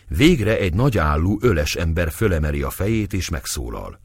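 usual speaking voice

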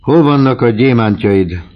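a husband's cold, cornering demand; stern demand. A narrator's reading. Husband confronts wife over diamonds.

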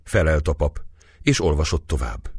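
usual speaking voice

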